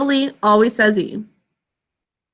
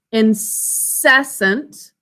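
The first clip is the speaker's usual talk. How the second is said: "incessant" very slow, split between syllables; much slower than usual. Shown as a demonstration. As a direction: sound out "incessant" with a long hissed s. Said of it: "Next syllable".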